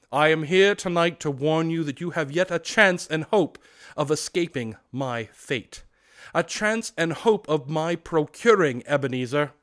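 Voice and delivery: loud solemn ghostly warning; unearthly voice. Acted. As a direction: warn gravely like a spirit. Ghost warns.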